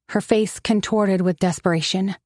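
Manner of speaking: ordinary delivery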